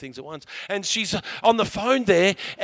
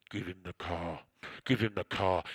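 very low, booming divine voice; God; 11 semitones lower than usual. Retold or imitated. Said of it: "this is what God's saying".

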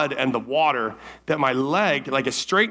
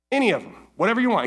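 Any said higher and hit hard; stressed any; 7 semitones higher, much faster than usual. Retold or imitated. God offering the garden to Adam, Any stressed. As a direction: lean on Any to widen the offer.

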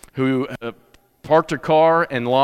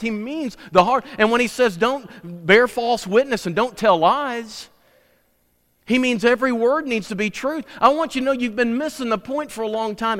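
higher, driving sermon voice; full-force preaching; 5 semitones higher than usual. Acted. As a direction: preach it out to the congregation. Preaching through Scripture examples.

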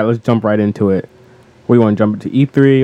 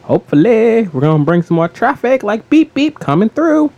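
high, playful sing-out; very energetic; 12 semitones higher than usual. Pretend. Co-host joking about bringing more traffic, very energetic. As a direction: climb higher and goofier to the end. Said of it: "I'm just very energetic".

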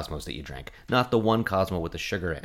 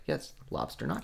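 whispered deadpan, much softer; 7 semitones lower, 10 dB quieter than usual. Retold or imitated. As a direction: whisper it as if obvious.

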